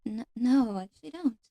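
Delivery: soft, hesitant fairy in ASMR roleplay; gentle, halting fairy confiding softly; soft-spoken nature fairy. Acted. Fairy tells a visitor of her past, soft-spoken.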